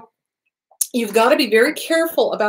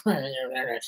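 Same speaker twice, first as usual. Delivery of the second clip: a low, much softer mock grumble; 9 semitones lower, 11 dB quieter than usual. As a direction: mutter the word like a sulking customer. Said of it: "be going".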